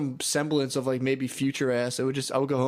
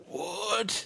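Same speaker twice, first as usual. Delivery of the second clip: higher, softer stunned question; mock disbelief; 7 semitones higher, 6 dB quieter than usual. A guy just turned down, mock disbelief. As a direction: act crushed and incredulous. Retold reaction to rejection.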